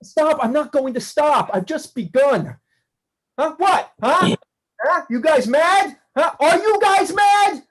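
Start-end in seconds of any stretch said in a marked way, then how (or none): 3.4-7.6 mocking rage. [name] jeering at the aliens, full of scornful fury. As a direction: sneer at them with scornful fury.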